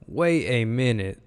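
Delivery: slow, swooping double take; drawn-out disbelief. Retold or imitated. Analyst reliving his first look at the play, slow disbelief. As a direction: stretch it out in retold disbelief.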